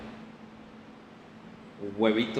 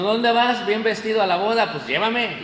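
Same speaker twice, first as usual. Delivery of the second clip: high, loud, eager neighbour calling out; nosy neighbour; 8 semitones higher, 5 dB louder than usual. Acted. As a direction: act out a pushy neighbour, pitched up and loud. Vecino que se pega a la boda. (vecino entrometido, voz aguda y fuerte)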